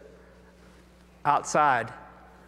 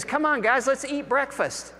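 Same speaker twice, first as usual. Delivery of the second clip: a much higher, cheerful call to come eat; friendly invitation; 9 semitones higher than usual. Retold or imitated. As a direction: wave the tired crew in like an old friend. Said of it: "He says".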